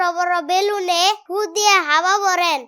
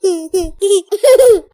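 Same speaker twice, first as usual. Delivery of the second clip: loud giggle; 8 dB louder than usual. Acted. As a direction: burst of loud, rising laughter. Child laughs at uncle's tiger explanation. (জোরে খিলখিল হাসি)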